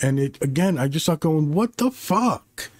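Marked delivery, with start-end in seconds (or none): 1.5-2.7 drawn-out, high-pitched incredulous outburst; exasperated disbelief. Retold or imitated. Viewer reacting to the Sauron reveal, exasperated.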